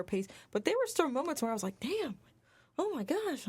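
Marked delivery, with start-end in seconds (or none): 1.8-3.5 higher-pitched mock shock; stunned reaction, acted out lightly; retold amazement. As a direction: replay a stunned viewer's reaction. Retelling her shocked reactions while watching.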